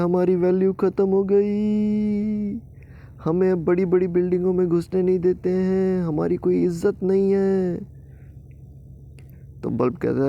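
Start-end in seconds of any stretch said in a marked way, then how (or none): 0.0-7.8 high, slow, hammed-up weepy voice; mock sobbing complaint. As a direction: whine out the lost honour. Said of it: "पंखा और cooler हैं वो बेचारे रो रहे हैं". (रोते हुए शिकायत)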